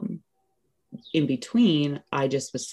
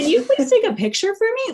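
much higher and louder, bubbling with excitement; thrilled request; 7 dB louder than usual. Retold or imitated. Asking her husband to photograph the birth, thrilled and high. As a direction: beg for the photo like a delighted kid.

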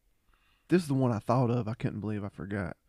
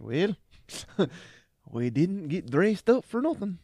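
higher, laughing as he quotes; can barely get the line out for laughing; giggling retelling of the battle quip; quote through laughter; 7 semitones higher, 3 dB louder than usual. Retold or imitated. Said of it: "and you're just like".

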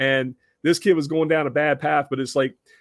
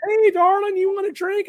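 high-pitched, louder mock drunk come-on; drunk kid voice; 3 dB louder than usual. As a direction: play the tipsy young guy chatting up a stranger's wife. Drunk kid hitting on wife.

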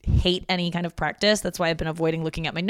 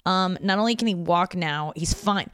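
ordinary delivery

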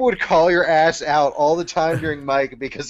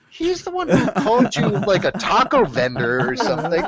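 high, loud whiny defence; mock indignant protest; 7 semitones higher, 5 dB louder than usual. Student protesting to teacher, high whiny and laughing. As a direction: squeal out your innocence, laughing. His own protest retold.

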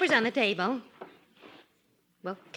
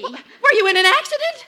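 higher, louder worried question; alarmed; 11 semitones higher, 9 dB louder than usual. Acted. Wife fearing her husband was hurt. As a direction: ask in a fright.

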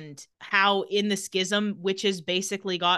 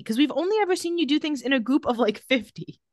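usual speaking voice

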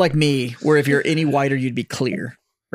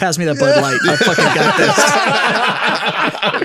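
cocky dude; 6 semitones higher, 4 dB louder, much slower than usual. Retold or imitated. Mock tough guy taking over.

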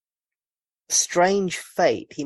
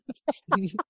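high breathy giggle mid-rhyme; 6 semitones higher, 4 dB quieter than usual. Real, not acted. Laughing at his own rhyme joke with the hosts, high giggle. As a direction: laugh between the lines.

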